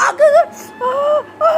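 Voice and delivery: very high pretend wailing yelps; mock cry of pain. Mother playing hurt as child pokes her, yelping. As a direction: play up the hurt, crying out.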